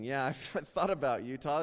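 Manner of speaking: sarcastic laugh. Retold or imitated. Sarcastic reply about growing up in Wyoming.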